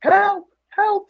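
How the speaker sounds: hammed-up helpless wife calling for help; mock cry for help. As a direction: squeal it like a helpless onlooker. Mocking the wife in the ring.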